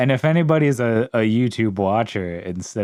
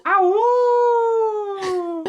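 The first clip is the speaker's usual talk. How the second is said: mock yelp. Mock pain cry in intro.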